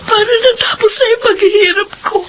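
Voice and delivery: high, crying outburst; weeping despair. Acted. Almira tells her sister she wanted her suffering to end, weeping. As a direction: sob out the despair.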